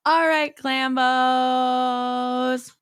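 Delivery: loud, held-out call to listeners; drawn-out sign-off. Real, not acted. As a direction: call out and stretch the last word. Calling out the show's sign-off.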